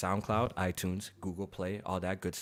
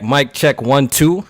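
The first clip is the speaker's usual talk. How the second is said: mic check; 4 semitones higher, 14 dB louder, slower than usual. Shown as a demonstration. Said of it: "How do I sound?".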